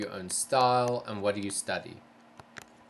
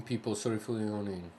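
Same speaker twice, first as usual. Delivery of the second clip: normal talking voice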